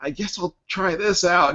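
high, hesitant try-out voice; unsure kid. Retold or imitated. Miles about to fake an accent, high hesitant voice. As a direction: test the idea with nervous uncertainty. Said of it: "he's like".

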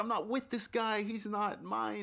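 high-pitched protesting disavowal; mock frantic disclaimer. Imagined plea to the insulted passenger, high and frantic. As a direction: disown him in a high, pleading voice. Imagined disowning of the ranting man.

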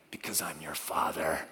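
low whispered father authority; hushed stern father line. Retold or imitated. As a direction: whisper it like a final word to a child.

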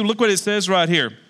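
ordinary talk, nothing marked

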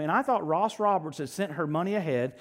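normal talking voice

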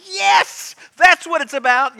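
high, loud celebration; jubilant cry. Acted. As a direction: burst out like someone just healed. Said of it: "they're laughing and going".

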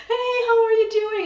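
exuberant, squealing friendliness; childlike greeting. Shown as a demonstration. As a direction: greet like an excited little child. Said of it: "I'm very much in my child".

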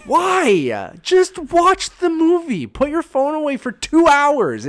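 high, pleading why-bother rant; exasperated rant. Retold or imitated. Retelling his silent rant at a girl texting in a cinema, exasperated. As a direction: beg them to just watch the film.

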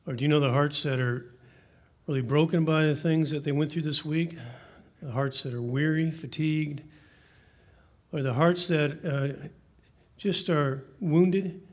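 soft, earnest pastor's prayer. Acted. Pastor praying over the hearts in the room, soft and earnest. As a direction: pray over the room, unhurried and hushed.

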